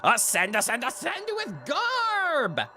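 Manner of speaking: very high, very slow ghostly commands; high drawn-out chant of a self-proclaimed god; Gorb voice. Acted. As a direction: order the worshippers to bow and ascend, stretched out. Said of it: "I made a good choice with this voice".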